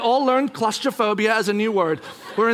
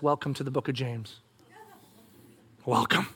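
low, hushed divine voice; quiet, deep-pitched welcome from above; God speaking; 8 semitones lower, 8 dB quieter than usual. Acted. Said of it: "God speaks and says".

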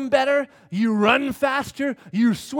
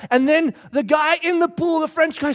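ordinary talk

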